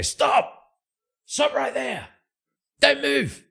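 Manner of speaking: high, loud guard shout. Acted. City guards yell at the Shadow by the body, high and loud. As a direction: bark the order to halt across the alley.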